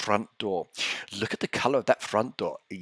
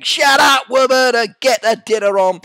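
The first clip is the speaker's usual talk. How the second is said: loud, much higher mock yell of an angry dad; imitating a shouting father; 14 semitones higher, 12 dB louder than usual. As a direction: bark the order like a hammed-up angry father. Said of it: "You could hear the father saying".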